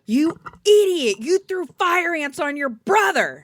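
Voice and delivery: high, loud scolding yell on request; outraged dad. Retold or imitated. Retelling what Dad said for co-host, high outraged yell. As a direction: imitate a dad hollering at his child. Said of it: "tell me again what Dad said".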